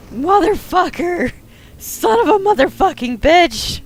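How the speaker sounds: high, loud swearing tirade over the lost phone; furious rant. Acted. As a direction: rage at the burning car. Raging over burning phone.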